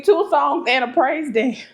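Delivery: ordinary talk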